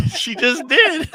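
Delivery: host cracking up, high laughing voice. Real, not acted. Host laughing at the hyped customer, high laughter. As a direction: squeal through laughter.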